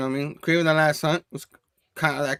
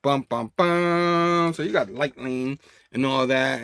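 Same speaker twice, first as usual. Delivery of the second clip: usual speaking voice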